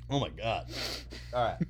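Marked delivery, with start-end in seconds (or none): none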